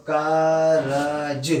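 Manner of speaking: drawn out very slowly, syllable by syllable; slow-motion syllables. Shown as a demonstration. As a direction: stretch each sound of कारज slowly as a teacher. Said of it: "जब हम मिलाएंगे".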